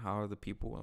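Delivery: normal talking voice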